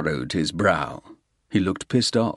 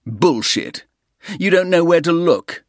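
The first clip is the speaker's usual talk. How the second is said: higher and louder furious denial; angry outburst; 11 semitones higher, 6 dB louder than usual. Acted. Soames shouting denial at the Russian, angry. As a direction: snap back in rage.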